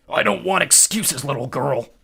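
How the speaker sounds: loud slow growling war god; angry growl. A narrator's reading. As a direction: growl down at her. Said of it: "he growled".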